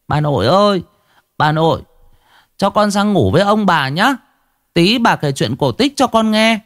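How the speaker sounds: put-on high, eager child voice; little grandson calling out. A narrator's reading. Grandson asking grandmother for a bedtime story, childlike calling. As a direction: call sweetly in a small boy's high voice. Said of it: "thằng cháu nội của ông bà chạy giam nó kêu lên".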